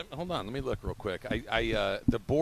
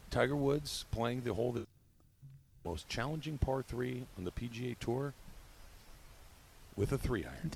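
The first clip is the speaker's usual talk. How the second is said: hushed golf call with long dramatic pauses; golf commentator with pauses; 8 dB quieter than usual. Shown as a demonstration. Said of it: "you need a few long pauses in there".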